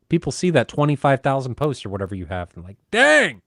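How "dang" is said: high, loud exclamation of awe; mock amazement. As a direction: imitate an impressed onlooker, pitch leaping up. Voices impressed forum readers.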